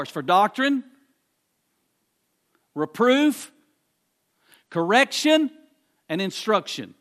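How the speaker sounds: preacher building a list to a peak; building preaching. Acted. Listing scripture's purposes, building.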